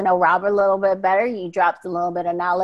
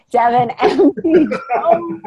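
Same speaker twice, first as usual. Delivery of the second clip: name broken by a laugh; giggling mid-name; laughing through name; 6 semitones higher, 4 dB louder than usual. Real, not acted. Laughing as he retries his sign-off name. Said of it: "let's try that again".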